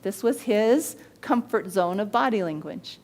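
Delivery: ordinary talk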